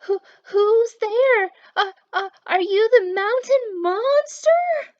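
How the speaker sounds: high, loud, slow quavering call; trembling voice. Acted. Maxwell calling into the dark, voice trembling. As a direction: call out with a stammering shake. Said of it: "Maxwell's voice trembled".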